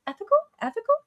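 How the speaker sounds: very high, squeaky questioning; puzzled mock voice. Acting out Kira's puzzled reply.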